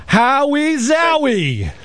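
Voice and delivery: high, slow hype-man intro; announcer voice. Pretend. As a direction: stretch out the name like a host. Hyping up a caller's entrance.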